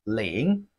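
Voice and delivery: "ling" dipping low, then rising; falling-rising tone. Shown as a demonstration. Teacher models Chinese zero for learners, falling-rising "ling". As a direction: draw out "ling" in a dip and rise. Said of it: "Falling and rising tone".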